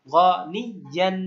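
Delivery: গনি ইয়ান said at half speed, each syllable clear; slow recitation. Shown as a demonstration. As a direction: spell out গনি ইয়ান slowly. (গনি ইয়ান ধীরে স্পষ্ট)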